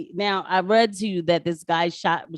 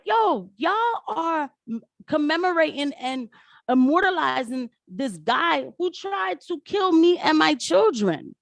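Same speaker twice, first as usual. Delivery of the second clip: high, drawn-out indignant complaint; slow, raised-pitch protest in her place; retold outrage; 9 semitones higher, slower than usual. Woman confronting those honoring her attacker, retold outrage. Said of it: "She's like".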